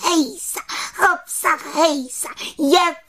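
very high, ringing witch chant; jubilant little witch. A narrator's reading. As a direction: cheer it out to echo over the mountain. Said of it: "jásala malá čarodějnice".

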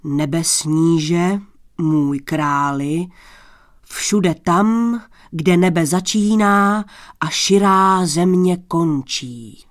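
slow, loud proclamation; booming, stately boast; grandiose thunder-voiced prince. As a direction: proclaim your boundless realm, drawing out the words. Boastful stranger answers the king.

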